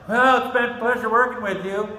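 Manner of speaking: high, loud sing-song mimicry of officials; mocking high-pitched parody of the officials; mocking fake politeness. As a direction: sneer it out like a fake-nice bureaucrat. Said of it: "they acted like".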